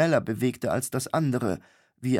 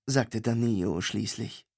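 usual speaking voice

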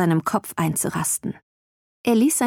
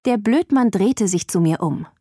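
ordinary delivery

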